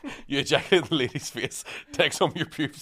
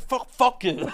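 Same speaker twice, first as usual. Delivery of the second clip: mock swearing; 10 semitones higher, much slower than usual. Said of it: "she went".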